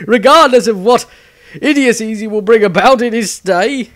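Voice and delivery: high, loud words still shaking with laughter; laughing. Acted. Viez still laughing as he rules on the fool's fate. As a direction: laugh through the verdict. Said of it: "unable to control himself any longer".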